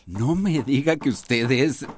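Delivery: stunned by the woman he expected; astonished. Acted. Realizing she is the teacher.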